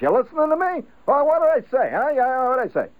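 testing whether the wife is listening; bugged father quizzing. Retold or imitated. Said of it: "He will quiz her on this".

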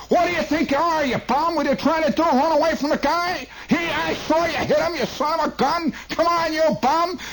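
high-pitched shouting at the driver; hollering passengers. Retold or imitated. Bus passengers hollering at the driver, high angry shout. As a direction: rage at the driver like a mob. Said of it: "hollering at the bus driver".

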